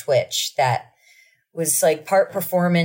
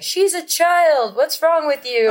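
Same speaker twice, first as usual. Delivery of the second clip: much higher, slow outraged scolding; indignant crowd; 12 semitones higher, 3 dB louder, slower than usual. Retold or imitated. Mimicking elevator crowd scolding the star, outraged. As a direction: scold like shocked bystanders.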